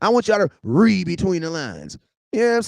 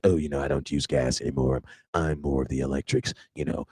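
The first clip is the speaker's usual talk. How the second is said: much lower, softer smug mock voice; 6 dB quieter than usual. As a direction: play the smug convert, low. Mocking an ex going electric.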